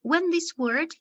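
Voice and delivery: usual speaking voice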